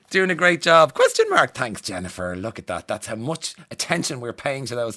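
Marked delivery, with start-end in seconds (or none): 0.0-1.5 amused squeal. Acted. Rereading text, laughing at himself.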